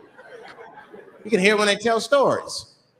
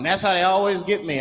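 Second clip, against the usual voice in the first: ordinary delivery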